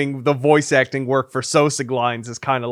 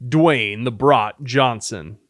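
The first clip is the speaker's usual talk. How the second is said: ordinary talk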